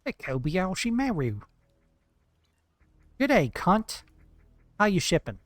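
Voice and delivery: playful put-on Australian greeting; Australian accent. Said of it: "that's Australian, though".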